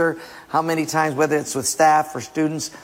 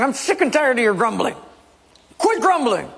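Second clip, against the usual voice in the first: angry Moses scolding; 8 semitones higher than usual. Acted. Acted angry Moses scolding people.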